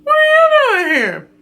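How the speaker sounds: high, startled mock gasp of a question. Emma startled to see Kieran pop up, high surprised voice. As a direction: act shocked to see him. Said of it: "She's like".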